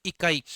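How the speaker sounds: इकाई with the first इ clipped short and the last ई stretched long; quick short i. Shown as a demonstration. Teacher shows the class the right way: quick short first i. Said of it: "इकाई बोलोगे तो जल्दी से".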